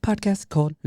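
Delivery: high, showy ringmaster welcome; announcer voice. Acted. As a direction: announce it like a circus host. Announcer-style show opening.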